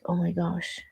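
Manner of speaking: soft, breathy-free hush of disbelief; hushed amazement. Retold or imitated. Reacting to a man who openly shows emotion, hushed. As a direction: say it under your breath, stunned. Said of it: "I was like".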